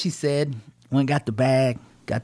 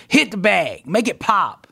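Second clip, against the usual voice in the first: higher, louder urging, lightly acted; mother coaching; 5 semitones higher, 4 dB louder, slower than usual. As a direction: lightly imitate a mama egging on a fighter. Imitating mama coaching over phone.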